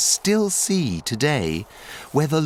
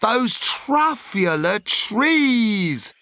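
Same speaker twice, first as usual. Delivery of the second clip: high, slow, swelling delight; rapturous awe; 7 semitones higher, much slower than usual. Acted. Onceler gushes over the truffula trees, high, slow and rapturous. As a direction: linger on each trees, climbing higher.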